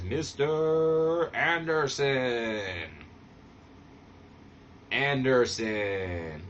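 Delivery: drawn-out, slow ring announcer call of Mister Anderson; announcer name call. Retold or imitated. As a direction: stretch the name like an arena announcer.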